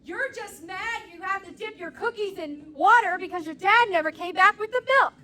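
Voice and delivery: lightly voiced kid roasting his sibling; kid yelling insult. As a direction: play the smug nine-year-old's zinger. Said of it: "what I heard my nine-year-old yelling".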